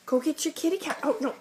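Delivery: normal talking voice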